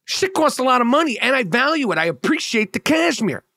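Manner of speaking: high-pitched indignant complaint, retold; retold upset. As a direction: pitch jumps up as you protest, mock-aggrieved. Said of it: "I'm really fucking pissed off and I'm upset".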